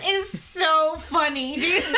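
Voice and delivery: ordinary delivery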